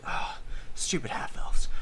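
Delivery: whispered grumble; mumbled under breath. Retold or imitated. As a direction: hiss it to yourself, annoyed. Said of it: "mumbles under his breath".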